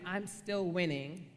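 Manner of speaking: ordinary talk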